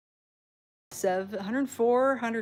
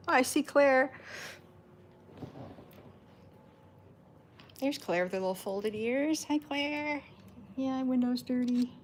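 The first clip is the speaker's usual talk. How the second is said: cooing pet talk; 5 semitones higher than usual. Real, not acted. Owner cooing to her cat Claire through the window, sing-song baby talk. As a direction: coo at the kitty through the glass.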